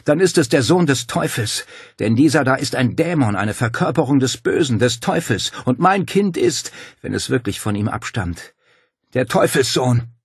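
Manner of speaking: loud, high, impassioned accusation; vehement denunciation. A narrator's reading. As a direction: hammer out the damning conclusion. Woman denounces the demon.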